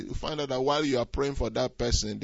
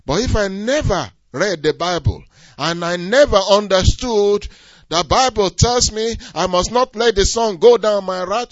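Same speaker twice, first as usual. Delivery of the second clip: loud, higher pulpit build; preaching at full force; 8 semitones higher, 10 dB louder than usual. Acted. Preacher describing anger growing into bitterness, loud and climbing. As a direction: climb with each step of the anger.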